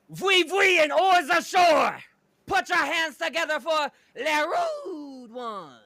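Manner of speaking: high, loud hammed-up bad French accent; terrible French accent. Rick Rude hypes the crowd, terrible French accent. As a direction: belt it out in a mock French accent. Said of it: "speak in a terrible French accent".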